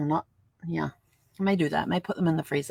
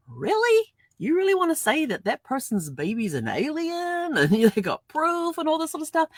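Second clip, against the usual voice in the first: much higher, slow incredulous scoff with a laugh; mock disbelief; 9 semitones higher than usual. She scoffs at tabloid headlines, much higher incredulous. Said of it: "I'm like".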